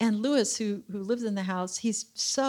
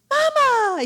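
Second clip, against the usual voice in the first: high, loud call across the street; a high, loud, happy "Mama!" called out to a passing couple; yelled greeting; 8 dB louder than usual. Retold or imitated. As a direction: shout it out like a happy neighbor. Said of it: "he yells out".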